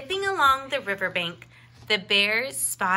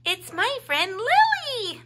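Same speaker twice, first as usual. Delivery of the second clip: much higher, louder, beaming; beaming bunny; 13 semitones higher, 7 dB louder than usual. A narrator's reading. Little bunny proudly introducing his friend. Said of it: "beamed Oliver".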